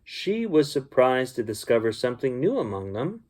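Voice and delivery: usual speaking voice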